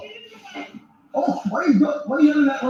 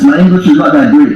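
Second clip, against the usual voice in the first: louder mock French accent with broken English; fake French accent; 4 semitones lower, 7 dB louder than usual. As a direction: pretend to struggle with English. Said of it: "I'm from France".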